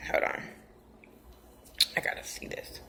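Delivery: real whispered aside off to the side. Real, not acted. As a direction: breathe it, almost no voice. Aside off-mic while checking something.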